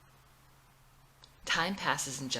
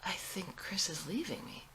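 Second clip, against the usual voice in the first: soft, unvoiced whisper; whispering her fear to a friend; hushed confession; 2 semitones higher, 7 dB quieter than usual. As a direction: barely admit it.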